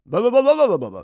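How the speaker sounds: loud mock blabbering over people; hammed-up gibberish rant, rising high and loud; Tim Scott. Host mimics a candidate talking out of turn, loud blabbering. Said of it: "Tim Scott would go".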